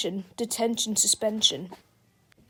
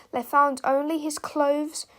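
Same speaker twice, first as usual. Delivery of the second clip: ordinary delivery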